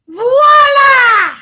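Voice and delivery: big, high, drawn-out shout; triumphant announcement. Pretend. Host unveiling the word of the day, triumphant shout. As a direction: belt it out like a fanfare.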